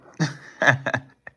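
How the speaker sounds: loud, high burst of laughter. Real, not acted. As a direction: laugh at your own joke. Laughing at his own bit.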